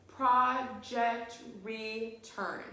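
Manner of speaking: very slow, each syllable pulled apart; teacher to class, counting syllables: very slow and drawn out. Shown as a demonstration. Spell out project return beat by beat. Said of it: "put your hand under your chin and say".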